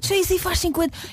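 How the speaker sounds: surprised. Real, not acted. Amazed at star's age.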